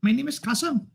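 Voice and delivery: very high-pitched comic character voice; put-on character voice. Introducing himself as Kasim and forbidding others to talk, in a very high put-on voice. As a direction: squeak it up as a silly character.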